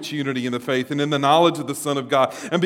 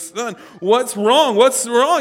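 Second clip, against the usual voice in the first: high, slow, anxious mother; mother rushing to her fallen son, worried; 7 semitones higher, 5 dB louder, slower than usual. Retold or imitated. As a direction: call out like a fretting mom. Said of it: "his mother says".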